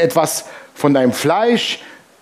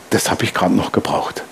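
low breathy aside under the breath; muttered thought; 8 semitones lower, 4 dB quieter than usual. Retold or imitated. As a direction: mutter it to yourself, fed up. Sarkastischer innerer Gedanke, geflüstert. (leise gemurmelt, genervt)

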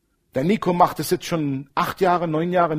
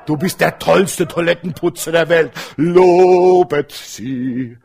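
mock praise; 6 dB louder, slower than usual.

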